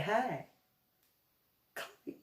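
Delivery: small, soft, high hello; hushed cautious offer; acted gentle approach. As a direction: tiptoe in with a timid little voice. Retold careful approach from side.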